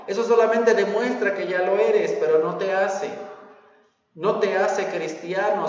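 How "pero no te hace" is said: ordinary delivery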